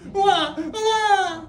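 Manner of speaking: put-on loud, high baby cry; imitated newborn wailing. A narrator's reading. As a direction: wail out two newborn cries. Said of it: "Two voices are now singing their first duet".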